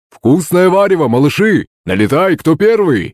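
high, lively rallying call; calling to dinner. A narrator's reading. Kutuzov calling kids to the soup, lively. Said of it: "продолжил Кутузов".

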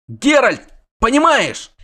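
high, loud and urgent; excited call. Acted. Dandelion calls to Geralt, high and excited. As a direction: grab his attention loudly.

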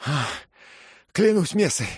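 high, loud startled oath; excited exclamation. A narrator's reading. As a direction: burst out with relief. Dick gasps after being pulled out.

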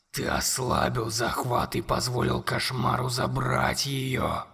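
very low, slow, whispered monster voice. A narrator's reading. Monster whispering accusations to Conor, low and slow. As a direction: loom over the boy and breathe every word.

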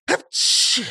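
sudden high sneeze; acted sneeze. Robber interrupts the chief's plans, loud achoo sneeze. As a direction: let out a big "achoo" sneeze. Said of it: "спросил Нисычих".

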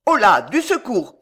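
loud, urgent call; shouting for help. A narrator's reading. Pardeillan calls for help as Alice faints, urgent shout. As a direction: call out sharply for aid. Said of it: "cria Pardeillan".

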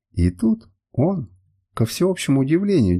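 usual speaking voice

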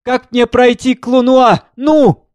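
high, loud, impatient demand; loud girl. A narrator's reading. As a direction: demand it loudly, pitched right up. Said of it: "Девушка громко". (громко, высоким голосом)

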